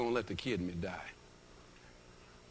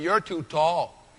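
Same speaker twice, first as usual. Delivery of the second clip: slow, louder, taunting "You're too tall"; boy next to him sneering at his height, slow; mocking boy; 4 semitones higher, 6 dB louder, much slower than usual. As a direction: drag it out to mock the tall kid. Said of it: "He looked up and said".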